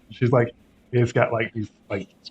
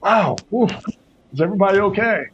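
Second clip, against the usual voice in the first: lightly higher, relieved exhale; relieved pony voice; 7 semitones higher than usual. Acted. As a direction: sigh with relief, then check on friends. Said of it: "She said".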